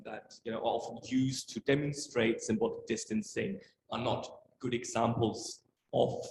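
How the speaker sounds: ordinary talk, nothing marked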